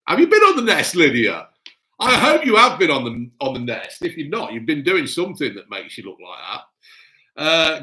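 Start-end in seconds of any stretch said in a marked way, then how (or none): 0.1-4.0 playful raised-voice grilling; teasing interrogation. Pretend. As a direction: call her out loudly in jest. Mock quizzing of Lydia, teasing.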